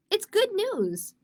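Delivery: high, slow, sing-song reassurance; cheerful mom. Acted. Mom telling Zoe her fall is good news, beaming. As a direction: make the news sound delightful.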